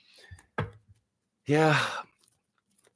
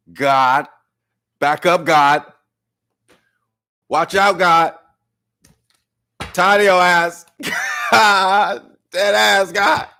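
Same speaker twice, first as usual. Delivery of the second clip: loud, higher mock telling-off; playful scolding; 6 semitones higher, 6 dB louder, slower than usual. Real, not acted. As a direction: snap at God laughing.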